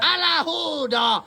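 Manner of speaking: high, slow yell across the garage; real shout. Real, not acted. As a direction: bellow it out to stop the noise. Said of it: "kun mä huusin tolle Valtterille". (kovaa huutoa)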